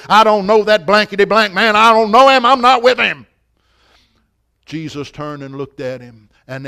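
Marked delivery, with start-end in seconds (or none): 0.1-3.2 loud, heated acted denial, rising; angrily disown him, louder and higher; vehement denial. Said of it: "he added a few expletives with it".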